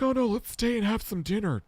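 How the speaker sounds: very high put-on voice; strange woman's voice. Man imitating a hookup who wants dinner, high strange voice. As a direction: mimic an odd woman begging you to stay. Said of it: "she had a very strange voice".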